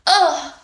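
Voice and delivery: high "ugh" as if punched; mock grunt of taking a hit. Shown as a demonstration. Streamer testing punch noises for her character, high grunt. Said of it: "think of the noises I'm gonna make".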